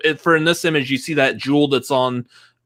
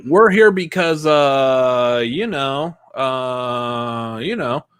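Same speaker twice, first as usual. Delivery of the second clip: drawn-out, halting hedging; hesitant hinting; much slower than usual. Retold or imitated. Herb wants to reveal the secret, hesitant hinting. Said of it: "Herb was seemingly wanting to".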